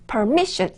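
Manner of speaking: permission with a clear stressed "mi". Shown as a demonstration. Teacher repeating permission to show the stress. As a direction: lean on the middle syllable of permission. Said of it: "M I에다가 강세를 넣어주시면 돼요".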